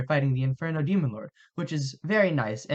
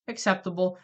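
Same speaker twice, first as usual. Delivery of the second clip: ordinary talk, nothing marked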